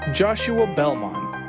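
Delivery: ordinary talk, nothing marked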